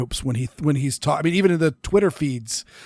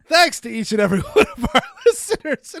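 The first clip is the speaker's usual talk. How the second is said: high, laughing while trying to speak; can't stop laughing mid-line; words broken by laughter; laughing through words; 9 semitones higher, faster than usual. Real, not acted. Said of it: "oh my God".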